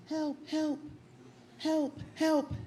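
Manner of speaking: high, soft squeaky help calls; tiny cries for help. Retold or imitated. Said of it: "All I heard was".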